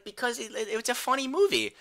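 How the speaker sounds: put-on a six-year-old explaining; little brother. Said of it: "He's like".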